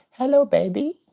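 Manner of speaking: very high parrot voice; imitating the bird. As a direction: mimic the bird greeting. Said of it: "he goes".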